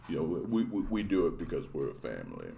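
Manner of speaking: low, earnest action-hero murmur; Vin Diesel impression. Streamer parodying Vin Diesel's family speech, low and soft. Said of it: "Vin Diesel".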